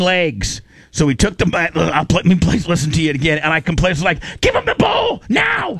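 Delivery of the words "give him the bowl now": high yelled command; urgent shout. Retold or imitated. Handler yelling at the bear, high panicked shout. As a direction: scream it to save yourself.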